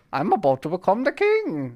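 put-on high, grand young prince. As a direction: announce it like a royal heir. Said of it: "Something like that".

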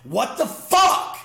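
slow, baffled outburst; incredulous. Retold or imitated. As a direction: drag it out, stunned at everyone. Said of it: "I'd be like".

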